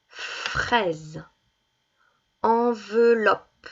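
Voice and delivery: put-on each word set apart and clearly sounded for children; name each picture slowly for a child; teacher reading a word list aloud; naming picture words. Shown as a demonstration.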